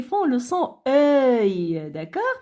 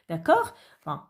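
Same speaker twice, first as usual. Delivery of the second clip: ordinary delivery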